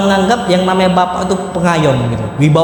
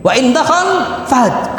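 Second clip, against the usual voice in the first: lion-like roar; 7 semitones higher, slower than usual. Acted. Preacher dramatizes a fierce husband.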